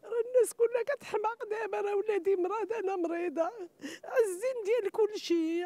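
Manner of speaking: sobbing with raw anger; angry crying. Real, not acted. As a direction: cry out in anger and grief. Grieving sister protests terrorism.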